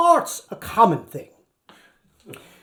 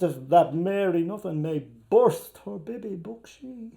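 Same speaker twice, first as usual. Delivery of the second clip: ordinary delivery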